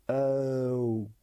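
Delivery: E sliding slowly down into O; gliding E-O sound. Shown as a demonstration. Teacher showing learners how two sounds join, gliding E-O. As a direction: glide from E to O as one sound. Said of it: "Vous entendez les deux sons".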